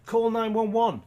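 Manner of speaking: high, loud mocking voice; a sarcastic, dopey-sounding quote; mock dumb suggestion. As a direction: parody the idea as silly.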